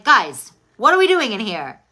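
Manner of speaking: mock frustrated outburst at two men; exasperated. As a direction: snap the group to attention. Frustrated in a breakout room.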